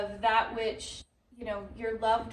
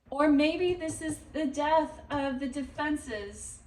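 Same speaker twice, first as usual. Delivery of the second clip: ordinary talk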